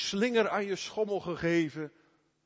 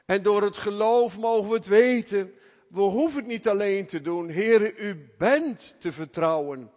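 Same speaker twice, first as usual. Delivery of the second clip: fervent preaching; 4 semitones higher, 7 dB louder than usual. Acted. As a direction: preach it out to the congregation. Preaching at full force. (vurig preken tot de gemeente)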